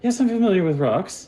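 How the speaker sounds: high wizard voice, rising end; testy lizard wizard. Acted. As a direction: answer high, as if humouring them. Game master voices the wizard.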